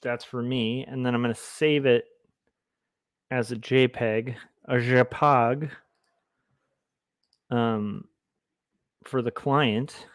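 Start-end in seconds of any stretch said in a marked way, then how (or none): 4.7-5.7 "J peg" said slowly as zhuh-PAHG; "J peg" as a drawn-out, French-sounding zhuh-pahg; mock-French pronunciation. Shown as a demonstration. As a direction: soften the J of "J peg" to zh. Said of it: "how I like to pronounce".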